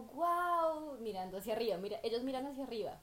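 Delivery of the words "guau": imitating a dazzled newcomer, guau high and loud; a wide-eyed, hammed-up guau; mock amazement. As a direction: tourist gawking upward. Mimicking amazed newcomers looking up.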